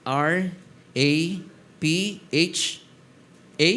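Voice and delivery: R, A, P, H, A, each letter said alone with pauses; spelled out letter by letter. Shown as a demonstration. Pastor to church, spelling out the word letter by letter. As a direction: spell Rapha slowly one letter at a time.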